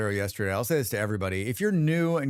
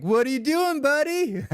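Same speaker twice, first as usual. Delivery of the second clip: playful raid call; 12 semitones higher, 4 dB louder than usual. Retold or imitated. Raiders greeting streamer mid-task.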